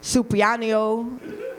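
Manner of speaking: slow, drawn-out fake Spanish word; mock Spanish. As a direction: stretch Supianio out in a joking fake Spanish accent. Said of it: "That's my Spanish".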